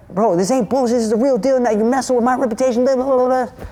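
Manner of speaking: high, hurried mock indignation; imitated outraged protest. Storyteller imitating the dude defending his reputation, high and indignant.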